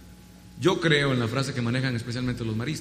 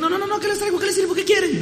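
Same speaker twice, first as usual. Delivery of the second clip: much higher, bustling mother voice; fussing mother; 3 dB louder than usual. Retold or imitated. Mother refusing to sit at the party, busy high fuss. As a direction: flutter about like a mom who won't sit. Mother insists on serving guests.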